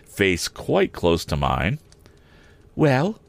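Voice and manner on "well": higher, rising taunt. Acted. Estella taunting Pip face to face with a higher rising "Well?". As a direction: throw it at him teasingly. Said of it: "said in her taunting manner".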